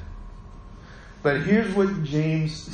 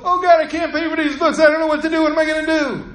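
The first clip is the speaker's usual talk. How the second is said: much higher, louder panicked wail, hammed-up; mock panic; 10 semitones higher, 3 dB louder, faster than usual. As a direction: act out the old helpless freshman, overdone. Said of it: "Instead, I went".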